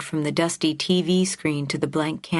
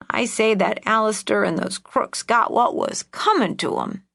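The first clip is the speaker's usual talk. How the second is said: put-on higher, spiteful old lady; old woman voice; 5 semitones higher than usual. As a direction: relish the grudge, crackly. Old aunt's grudge.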